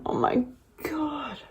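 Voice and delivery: soft whispered awe; awed whisper. Real, not acted. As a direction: breathe it out in wonder. Overwhelmed by palette.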